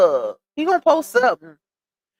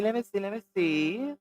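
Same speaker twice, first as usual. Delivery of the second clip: normal talking voice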